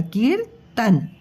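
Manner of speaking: कीर्तन drawn out slowly, the half र sounded before त; slow teacher demo of कीर्तन; slow model word. Shown as a demonstration. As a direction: stretch कीर्तन so the र is heard. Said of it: "अब ये half र जो है वो त के ऊपर आएगा".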